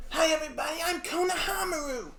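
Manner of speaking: very high-pitched, loud and excitable shout; shrill, full-volume character greeting; Konohamaru impression. Voice actor showing the Konohamaru voice, high and loud. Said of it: "his voice is so, so distinctive".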